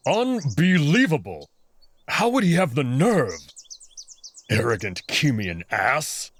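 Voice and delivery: seethe, building to fury; rising angry rant. Acted. Wolf fuming about the jackal down the hall, angry. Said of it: "muttering under his breath".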